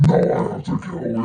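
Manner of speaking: loud, rasped monster threat; Freddy Krueger. Retold or imitated. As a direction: rasp it out as a killer's threat. Voices Freddy threatening to kill.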